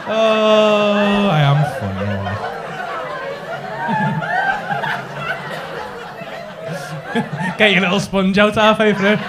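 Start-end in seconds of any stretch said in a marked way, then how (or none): 2.1-7.5 high cackling laugh trailing off; high cackle. Real, not acted. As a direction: cackle high, then let it fade. Still laughing at himself.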